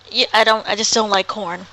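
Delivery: ordinary talk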